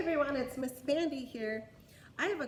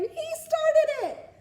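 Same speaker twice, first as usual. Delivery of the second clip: high, loud, slow blaming shout; accusing king; 10 dB louder, slower than usual. Acted. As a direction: point and blame like a child-king. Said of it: "the king pointed to the dragon".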